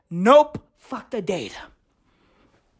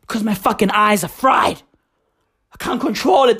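usual speaking voice

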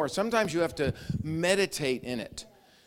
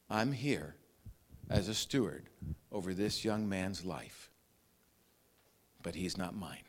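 much softer and lower, reflective; soft inner thought; 8 semitones lower, 8 dB quieter than usual. Pretend. Joseph quietly musing about the boy, soft. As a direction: muse it quietly to yourself.

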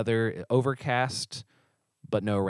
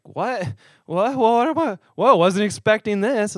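shocked disbelief; 10 semitones higher, 7 dB louder than usual. Retold or imitated. Retold shock at rebuff.

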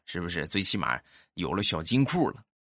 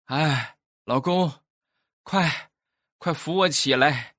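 anxious calling; 6 semitones higher than usual. Retold or imitated. Wife calling her husband from bed, eager and urgent. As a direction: call out eagerly from the bedroom. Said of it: "焦急的呼唤声".

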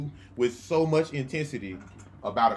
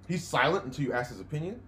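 ordinary delivery

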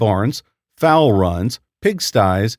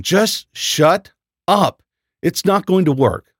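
slow, higher frustrated yell; screaming; 6 semitones higher, slower than usual. Acted. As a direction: snap at him in frustration. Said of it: "I screamed at Nat".

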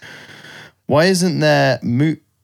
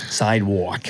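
put-on American accent on "Sidewalk"; mock American; 4 semitones lower, 3 dB quieter than usual. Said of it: "They always say long words".

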